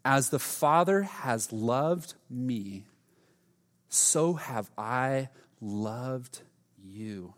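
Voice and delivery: very slow, soft reread of the verse. Real, not acted. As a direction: breathe it in, let it sink. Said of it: "take a breath. Let's read this again".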